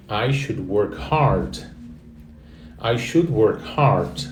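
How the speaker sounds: slow, even teacher model of "I should work hard"; slow model sentence. Shown as a demonstration. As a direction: say "I should work hard" slowly so they can repeat. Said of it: "Repete aí em voz alta". (frase modelo falada devagar)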